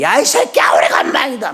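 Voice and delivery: hollering a crude greeting across the room; mock rowdy greeting shout. Old friend greeting a buddy in a café, high mock shout. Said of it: "양복 입고 점잖은 분들이요".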